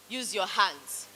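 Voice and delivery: ordinary talk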